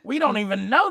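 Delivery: high, loud imitation of dad; mock exasperated dad. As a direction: burst in as the disbelieving dad. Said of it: "your dad just comes in".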